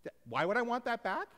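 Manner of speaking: high, loud repeat of the question; incredulous echo. Acted.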